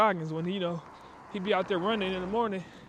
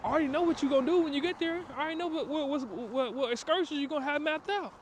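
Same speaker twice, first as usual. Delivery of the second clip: the work talking back; 7 semitones higher than usual. Retold or imitated. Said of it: "The work, like".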